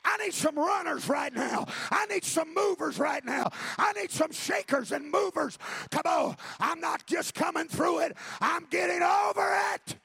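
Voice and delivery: loud, high, driving sermon voice; building revival preaching; preaching at full force. Acted. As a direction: rouse the church to get up.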